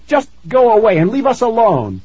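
put-on high, loud, insistent child's shout; shouted plea. A narrator's reading. Tara begs the ghost to leave, shouting. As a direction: drive him off with a high yell. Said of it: "Tara shouted".

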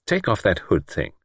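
ordinary delivery, nothing marked

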